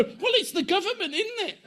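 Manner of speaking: high, laughing mock-naive question. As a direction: play the obvious answer with a laugh. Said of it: "And people always go".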